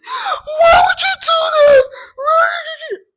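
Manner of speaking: very high, loud crying shout; imitated sobbing scream; anguished cry. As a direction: wail it in shock and pain. Lisa crying out after slam.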